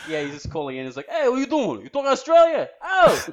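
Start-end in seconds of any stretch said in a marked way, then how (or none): 1.1-3.3 high, mock-aggressive Brooklyn caller; Brooklyn guy. Brooklyn guy calling in, high exaggerated Brooklyn accent. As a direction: put on a hollered Brooklyn caricature. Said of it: "There's a guy from Brooklyn on the phone".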